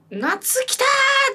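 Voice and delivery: drawn-out mock cheer of excitement; excited cheer. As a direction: act out a thrilled summer's-here moment. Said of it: "夏来たーって思うけど".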